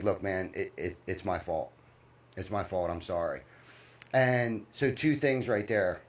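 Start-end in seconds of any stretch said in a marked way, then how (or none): 0.0-3.4 a soft, lower, sheepish apology; quiet apology. Retold or imitated. A hint of the worker owning up to the boss, softly. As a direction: say it's your fault quietly. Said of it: "And, and he was like".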